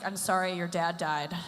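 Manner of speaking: low-key deadpan condolence; unmoved, expressionless sorry; frozen-faced apology. Acted. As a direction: offer sympathy with no feeling showing. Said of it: "I can't be that way 'cause my forehead's frozen".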